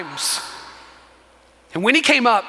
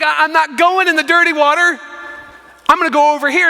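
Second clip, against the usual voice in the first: mildly indignant refusal, pitched higher; mock fit; 4 semitones higher, 3 dB louder, faster than usual. Naaman refusing to dip in the dirty river, mock fit. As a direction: act out a petulant objection. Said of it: "And he throws a fit".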